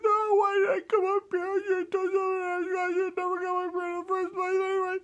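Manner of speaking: very high, whimpering lamb voice; hammed-up frightened lamb lament; wailing little lamb. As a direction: wail with regret in a tiny high voice. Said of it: "And I said".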